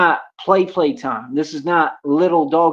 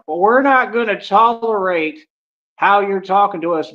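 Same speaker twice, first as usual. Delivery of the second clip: mock passive-aggressive; 5 semitones higher, 3 dB louder than usual. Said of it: "these people are so passive aggressive".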